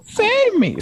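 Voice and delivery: high-pitched cry for help; damsel in distress. Retold or imitated. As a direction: squeak it like a captured princess. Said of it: "that girl was kind of muffled".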